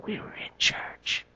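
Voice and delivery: whispered, slow, embarrassed reply; hushed and ashamed of the answer; sheepish whisper. Retold or imitated. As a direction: mumble it like you'd rather not say.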